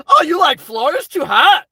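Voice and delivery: high-pitched mock astonishment; mocking critics. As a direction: mimic a naysayer up high. Mimics critics of Florida.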